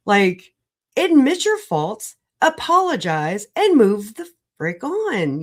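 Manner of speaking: ordinary delivery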